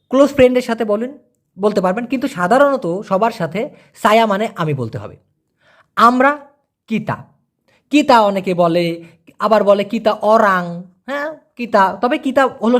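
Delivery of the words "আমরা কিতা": normal talking voice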